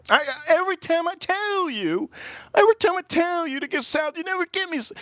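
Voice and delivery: mock the picky customer's whine; whiny woman. Mimics woman complaining about salad.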